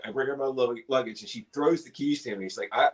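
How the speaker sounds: ordinary talk, nothing marked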